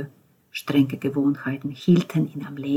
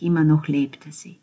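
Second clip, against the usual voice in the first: ordinary delivery, nothing marked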